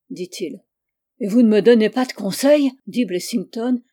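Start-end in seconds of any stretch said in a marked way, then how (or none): 1.2-2.7 loud, dismayed question; dismayed outcry. A narrator's reading. Client protests as detective leaves, loud and dismayed. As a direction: call after him in alarm. Dismissed client pleads for advice.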